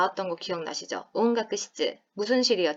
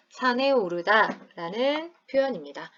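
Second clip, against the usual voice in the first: normal talking voice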